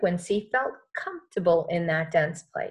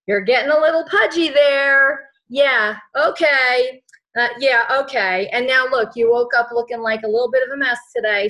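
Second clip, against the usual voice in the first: louder, higher mocking critic picking at looks; nagging ego voice; 3 semitones higher, 7 dB louder than usual. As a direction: needle yourself like a mean inner critic. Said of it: "the ego's like".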